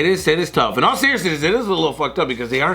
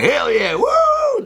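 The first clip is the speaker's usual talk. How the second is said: high cowboy whoop; much slower than usual. Acted. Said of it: "cowboys that are just like".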